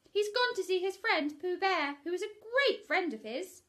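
high, loud put-on voice; disguised Rabbit. Rabbit fibbing to Pooh, high put-on voice. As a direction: pretend to be someone else in the hole. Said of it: "Rabbit's disguised answer".

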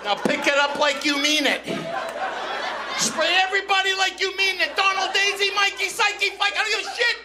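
high, loud yelling tirade; ranting angry dad. Retold or imitated. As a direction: blow up at the kid over the cost. Acts out angry father's rant.

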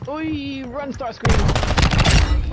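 high urgent yell at a teammate; combat callout. Real, not acted. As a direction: shout it out mid-fight. Frantic callout in firefight.

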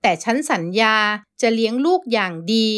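ordinary delivery

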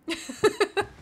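high giggle at own attempt; real giggle after fumbling a name; embarrassed laugh. Real, not acted. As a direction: burst into a high, self-mocking giggle.

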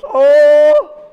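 loud, high yelp of alarm; alarmed cry. Acted. Miko sees the buffalo about to hit, alarmed cry. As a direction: cry out at the looming crash.

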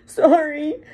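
loud, high, tearful sorry; sobbing apology. A narrator's reading. Hagrid apologizes to McGonagall, sobbing. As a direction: blurt it through tears. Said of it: "sobbed Hagrid".

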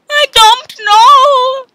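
high, loud, slow wail; panicked denial. Acted. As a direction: plead in mock panic.

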